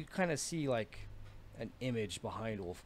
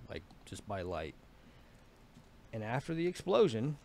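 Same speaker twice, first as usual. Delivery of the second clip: ordinary talk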